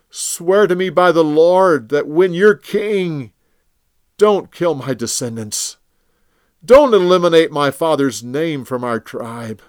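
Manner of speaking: a touch of crying in a loud plea; broken king begging mercy; tearful. Acted. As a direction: Saul weeping as he begs David. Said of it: "he broke down and cried".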